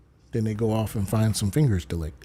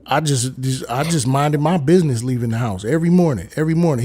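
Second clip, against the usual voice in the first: normal talking voice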